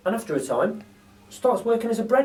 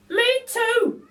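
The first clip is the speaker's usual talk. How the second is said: very high, slow, chirpy lady voice; a cheeky cockney burglar lady; Burglar Betty; 6 dB louder than usual. A narrator's reading. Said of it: "says Burglar Betty".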